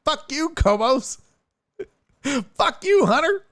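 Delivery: high-pitched laughing curse; laughing mock curse. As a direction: cackle it out while cursing. Reading tweets, mock outrage.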